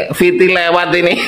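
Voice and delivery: ordinary talk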